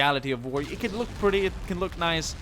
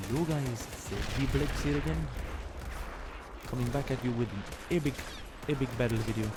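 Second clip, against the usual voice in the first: softer Mediterranean-ish accent, mixed and unplaced; put-on foreign accent; 6 dB quieter than usual. Shown as a demonstration. YouTuber repeating his greeting in a made-up Mediterranean accent. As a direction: redo the intro in a vague Mediterranean accent. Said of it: "could be a little Mediterranean kind of deal".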